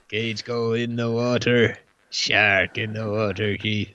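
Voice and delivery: put-on gruff old sea-dog Quint voice; Robert Shaw impression. Host dusts off his Robert Shaw for the chat, gruff sea-dog voice. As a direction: rumble it like a salty shark hunter. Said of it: "dust off my Robert Shaw".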